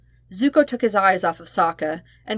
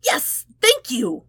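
high, delighted thank-you; excited cheer; 9 semitones higher, much slower than usual. Acted. Sokka pumps his fist at Zuko, high and delighted. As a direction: celebrate like you just got your way. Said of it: "Sokka pumped his fist".

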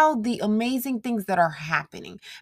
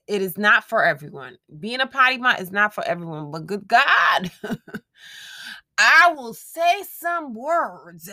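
ordinary delivery

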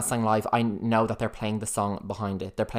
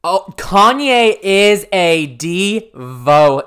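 slow, loud, higher, stretched-out diva voice; hammed-up slow diva proclamation; mock diva drawl; 7 semitones higher, 13 dB louder, much slower than usual. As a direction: drag out each word like a grand diva. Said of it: "I fucked it up".

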